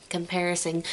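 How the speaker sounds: comparison muttered softly under breath; quiet mutter. Real, not acted. Muttered self-correction.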